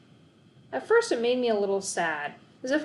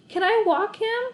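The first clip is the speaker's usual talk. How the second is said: eager asking; 13 semitones higher, 5 dB louder than usual. A narrator's reading. Boy begging to walk dog.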